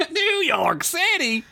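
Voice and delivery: high drawn-out mock cry; teasing high-pitched holler; mock exclamation. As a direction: echo it high and stretched, poking fun. Mock repeat of listener's city.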